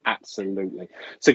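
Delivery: normal talking voice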